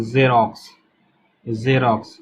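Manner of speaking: जेरोक्स with a buzzing z, zerox; जेरोक्स said zerox, teacher demo; z sound. Shown as a demonstration. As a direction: start जेरोक्स with a voiced z, not j. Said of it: "लेकिन ये जेरोक्स नहीं है".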